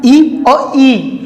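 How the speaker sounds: long drawn-out ई sliding into ई; elongated vowel demo. Shown as a demonstration. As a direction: stretch ई slowly until it becomes ई. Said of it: "इसको लंबा बोलना start किया".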